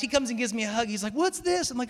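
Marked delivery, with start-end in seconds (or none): 1.1-1.7 high-pitched puzzled question; mock bewilderment. Friend hugging him at his own party, mock bewilderment. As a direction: imitate a baffled friend, voice jumping up.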